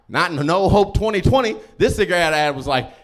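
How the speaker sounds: ordinary talk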